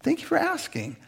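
lightly voiced thankful neighbor; a hint of a touched neighbor; neighbor's reply. She's like.

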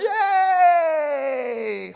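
very loud, high, drawn-out shout; overexcited cheer. Acted. Playing the overexcited one at a game, overexcited cheer. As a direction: cheer far too hard, hold it long. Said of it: "you get the overexcited".

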